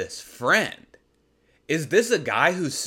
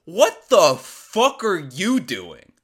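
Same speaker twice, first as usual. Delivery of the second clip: slow, loud, drawn-out disbelief; exasperated outburst; 4 semitones higher, 6 dB louder, slower than usual. Real, not acted. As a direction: drag out WHAT in full exasperation. Exasperated outburst at listener's friend.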